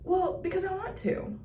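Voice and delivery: high, imitated kid's reply; cute kid. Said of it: "And he goes".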